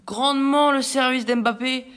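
loud, slow, stretched goal call. Real, not acted. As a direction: belt out the scorer's name.

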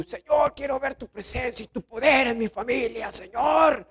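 imitated strained cry to the Lord; mock fervent prayer. Believer begging God for power in family, mock fervent prayer. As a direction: act a believer crying out in prayer.

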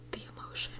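soft unvoiced whisper after a sigh; a real sigh over the movie's emotional ending. Real, not acted. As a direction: breathe it out, overwhelmed. Overcome after describing sad ending.

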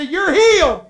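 loud, high healing proclamation; triumphant declaration. Acted. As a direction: proclaim it at full voice. Jesus declaring a leper healed.

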